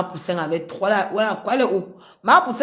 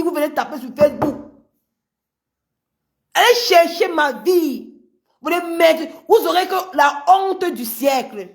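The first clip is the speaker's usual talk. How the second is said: raised, loud scolding; heated rant; 7 semitones higher, 4 dB louder than usual. Real, not acted. Scolding her critics on Facebook, loud and heated. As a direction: call them out, heated and high.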